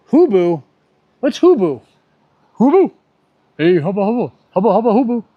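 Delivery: high, loud silly voice; loud, high-pitched goofy wordplay; goofy mock. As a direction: play with the funny word like a cartoon. Mocking reading "HBU" as a word.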